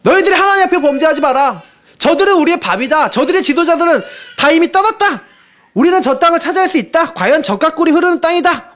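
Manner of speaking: high, urgent proclamation to the people; impassioned plea. Acted. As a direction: plead like a man tearing his clothes. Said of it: "옷을 찢어가면서".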